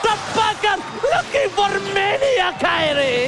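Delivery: loud, rising esports shout; fighting game announcer. Acted. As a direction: call the teamfight like an arena announcer. Sudden intense fight call. (penyiar pertarungan yang berteriak heboh)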